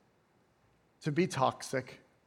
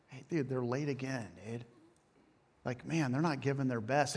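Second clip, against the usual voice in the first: confiding gossip; 2 semitones lower, 8 dB quieter than usual. Acted. Employee gossiping to another about lateness, hushed aside. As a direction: slip it quietly to a colleague.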